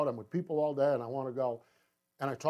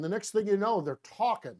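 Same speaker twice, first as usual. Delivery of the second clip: normal talking voice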